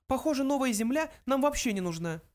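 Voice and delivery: high little mouse voice; high-pitched small creature. A narrator's reading. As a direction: state it as a tiny mouse. Mouse character speaks.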